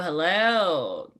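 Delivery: usual speaking voice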